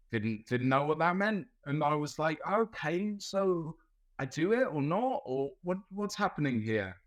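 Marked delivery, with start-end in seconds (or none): 2.5-7.0 higher, puzzled mock questioning; confused. Nurse recalling confusion over Aussie slang, higher and puzzled. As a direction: act out hesitant, baffled questioning.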